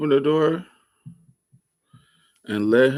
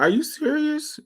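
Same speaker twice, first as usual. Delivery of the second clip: mock incredulous boy. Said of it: "he was like".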